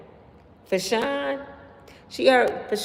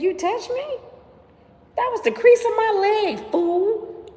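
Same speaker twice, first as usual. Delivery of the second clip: high, incredulous, laughing through it; woman mocking a man who barely touched her; mock outrage; 5 dB louder than usual. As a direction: scoff at him in a high disbelieving voice. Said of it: "You like".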